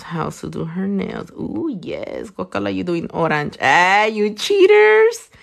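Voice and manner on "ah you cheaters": drawn-out teasing accusation, a touch louder; slow playful scolding of cheaters; mock accusing. Viewers guessed the color.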